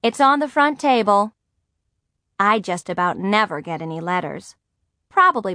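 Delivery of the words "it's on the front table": higher, mother calling from another room. A narrator's reading. Mother calls from the studio about the letter, calling out. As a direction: call it across the house. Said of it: "my mother called from the studio".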